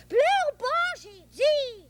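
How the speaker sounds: put-on high, loud, bossy child; scolding. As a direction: yell at the crying friend. Boy scolds imaginary friend.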